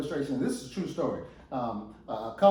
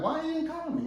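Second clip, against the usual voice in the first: much higher, plaintive question; acted wifely complaint, pitched way up; puzzled, miffed wife; 11 semitones higher than usual. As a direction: ask it like a neglected wife. Wife still waiting for call.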